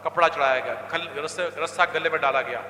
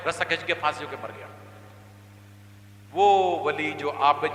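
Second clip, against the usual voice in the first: ordinary talk, nothing marked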